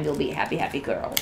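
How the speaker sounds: hoarse, fading voice; losing voice. Real, not acted. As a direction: voice giving out, rasping. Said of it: "Okay, I'm losing my voice".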